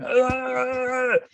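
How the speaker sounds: high, drawn-out wavering babble; delirious groaning noise. Acted. Imitating himself agitated in a hospital bed, babbling groan. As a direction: make a long agitated, wordless noise. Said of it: "Was I sort of lying in bed going".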